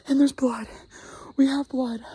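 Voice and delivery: loud, breathless burst of excitement; excited discovery. Real, not acted. Hunter spotting blood on the trail, blurting it out excitedly. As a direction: blurt it out as you spot the blood.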